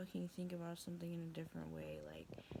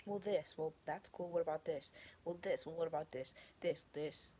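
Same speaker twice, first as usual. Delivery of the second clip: loud, punchy acted comeback; acted-out insistent retorts; mock rebuttal; 7 dB louder than usual. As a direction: fire it off like counterarguments in a debate. Acting out a rebuttal.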